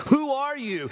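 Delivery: retold insistent question, pitched high; imitating an emotional, pleading demand; great emotion. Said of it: "in great emotion, this, this, this young man says".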